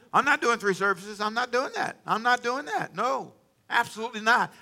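high-pitched, insistent mock refusal; adamant refusal. Imitated pastor refusing to add a service, adamant. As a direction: imitate a pastor digging his heels in.

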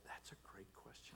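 near-whispered, low aside; hushed inner thought. Retold or imitated. Pastor caught out by seatmate's question, hushed thought to himself. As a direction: murmur it under your breath as a thought.

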